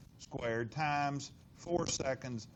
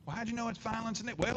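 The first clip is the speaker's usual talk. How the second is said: higher whiny student questioning; 7 semitones higher, much faster than usual. Retold or imitated. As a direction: play the skeptical student. Teacher voices a doubting student.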